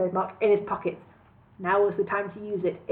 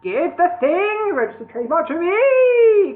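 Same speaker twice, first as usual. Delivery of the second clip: loud horrid goblin king; 8 semitones higher, 7 dB louder than usual. A narrator's reading. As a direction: gloat over the beaten enemy. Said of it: "called Cluck".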